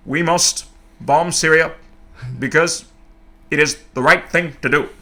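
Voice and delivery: slow deliberate Obama-style address; Obama impression. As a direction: deliver it like a solemn speech at the podium. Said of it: "Obama's more like".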